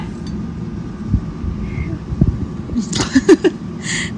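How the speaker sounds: long high, breath-only laughing; helpless laughter. Real, not acted. Mom soaked by her child, long helpless laugh. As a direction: laugh on and on till voiceless.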